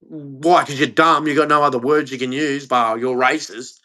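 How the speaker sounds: low, dismissive comeback, a touch hurried; retorting Brit of old. Retold or imitated. Acting out how Brits used to answer back, low retort. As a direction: lightly imitate a tough, unimpressed retort. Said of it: "Brits used to turn around and go".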